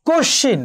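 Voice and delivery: কশিন said high as kosh-shin, with double sh; doubled consonant. Shown as a demonstration. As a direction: hold the sh in কশিন, no m. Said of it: "দ্বিত্ব হয়েছে।".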